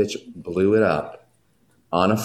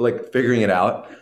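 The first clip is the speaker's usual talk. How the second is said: ordinary talk, nothing marked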